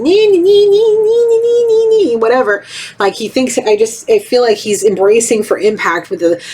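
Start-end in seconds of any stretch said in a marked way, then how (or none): 0.0-2.2 high, loud mock fangirl shrieks; fan scream. Woman opening the door, mock screaming in delight. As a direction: imitate a screaming fan at a concert. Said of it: "she's not screaming".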